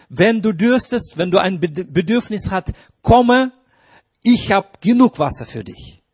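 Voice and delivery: calling out. Acted. Preacher voicing Jesus's invitation.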